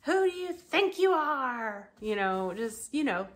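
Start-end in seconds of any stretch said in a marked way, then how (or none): 0.0-1.9 high-pitched mock threat, hammed-up; threatening rant. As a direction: spit it out like a furious jealous wife. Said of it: "threatening her, going off".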